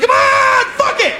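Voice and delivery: high, loud, frantic urging; demanding partner. Retold or imitated. Girlfriend loudly urging him on again. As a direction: yell at him to get going. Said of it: "She's like".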